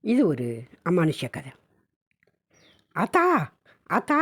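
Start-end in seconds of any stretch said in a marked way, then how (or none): 2.9-4.2 put-on a boy running home calling his mother, high call. Boy runs home calling mother, high mock call. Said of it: "என்று கத்திக்கொண்டே ஓடோடி வந்தான்".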